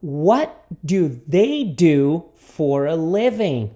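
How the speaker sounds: very slow, word-by-word question; repeat-after-me model. Shown as a demonstration. Teacher introduces the question to students, very slowly. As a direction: separate and lengthen each word. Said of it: "Repita comigo".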